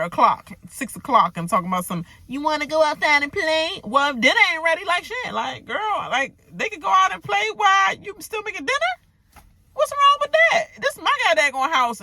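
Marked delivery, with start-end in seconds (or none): 2.3-3.8 mocking quote. Mocking the sister's words. 3.8-12.0 rising indignant rant, higher and faster; a mock-furious clapback climbing in pitch. Heated rant at the sister.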